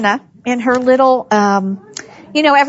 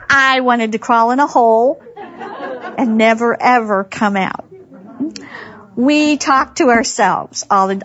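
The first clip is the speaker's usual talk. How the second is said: ordinary talk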